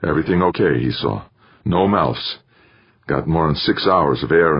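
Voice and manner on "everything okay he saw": plain reading voice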